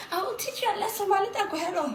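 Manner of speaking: imitating a man threatening her; mock threat. Quoting a Somali man.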